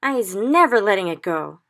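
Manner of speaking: loud fierce vow; cried. Acted. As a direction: swear it loudly. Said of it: "the B F G cried".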